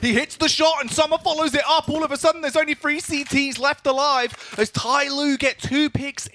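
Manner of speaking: high, loud excited call; shouting caster. Real, not acted. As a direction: yell the play as it happens. Caster shouting mid-play.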